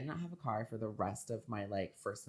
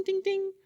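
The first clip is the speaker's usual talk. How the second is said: high-pitched tinkling ding ding; ping it like a knife on a glass; imitated glass ding; 8 dB louder than usual. Grandma tapping glass for a toast, high ding. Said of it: "one of those little ding".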